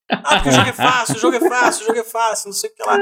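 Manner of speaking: high, sing-song mock quote; mocking whine. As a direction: parrot them in a high whiny voice. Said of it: "Aí ficou de boa no Twitter".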